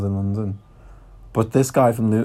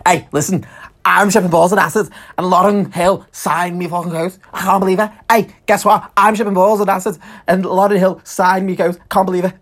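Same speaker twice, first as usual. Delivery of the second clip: much higher and louder, breathless bragging; excited boasting woman; 9 semitones higher, 6 dB louder than usual. Retold or imitated. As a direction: brag at full volume to every passerby. Voicing her boasting to passersby.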